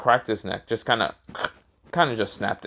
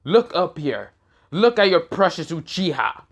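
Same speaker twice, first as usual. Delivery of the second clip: half-shouted taunt, pitched up; mock scream; 7 semitones higher, 3 dB louder than usual. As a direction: call out to a whole crowd below, high but not full volume. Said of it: "He just screams out".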